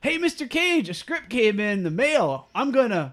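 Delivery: high, loud caller to a star; hammed-up movie acting. Telling a star about a script, hammed-up high loud delivery. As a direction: call it out high and loud, stretched in the middle. Acting out lines for table read.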